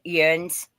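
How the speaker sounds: "Yuns" in local Pennsylvania dialect. Shown as a demonstration. Seller quoting how locals here say it, "Yuns" as yoonz. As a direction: say "Yuns" as one drawn syllable, yoonz. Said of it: "they say".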